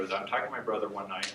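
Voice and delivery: normal talking voice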